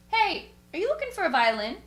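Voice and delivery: imitated stranger's loud, high call. Guy in an SUV rolls down the window to ask, calling out. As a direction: call it out like hailing someone from a car.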